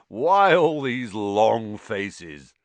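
loud, drawn-out jovial question; slow and booming, full of good cheer; beaming cry. A narrator's reading. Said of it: "he cried, beaming around him".

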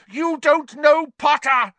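high, slow, loud raging shriek; Snape shrieking. A narrator's reading. Professor protesting to the minister, shrieking. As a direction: shriek it, beside yourself. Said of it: "shrieked Snape".

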